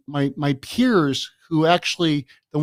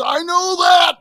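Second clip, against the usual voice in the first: much higher, louder retold yell; screaming; 6 dB louder, slower than usual. Vice principal yelling at the truant boy, retold shout. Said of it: "he started screaming at me".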